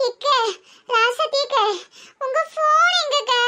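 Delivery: high, panting calls after a run; out of breath from running. Acted. Breathless village woman calling to her neighbour after running. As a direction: gasp the words out, breathless from running. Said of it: "மூச்சு வாங்குற அளவுக்கு ஓடி வரியே".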